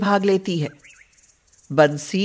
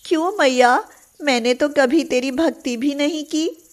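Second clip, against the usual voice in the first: higher, awed devotion in a cow's voice; grateful cow; 6 semitones higher than usual. A narrator's reading. Cow Radha thanks goddess Durga, higher and awed. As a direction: marvel at the goddess.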